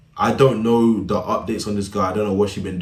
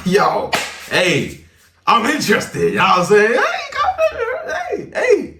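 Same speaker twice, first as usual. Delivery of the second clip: very high squealing laughing falsetto; mock eager girl; 5 dB louder, slower than usual. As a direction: ham up a giddy girl calling out.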